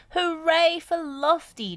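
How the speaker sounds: high, loud, slow cheer; triumphant hooray. A narrator's reading. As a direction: cheer the hero. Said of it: "cheered Wendy".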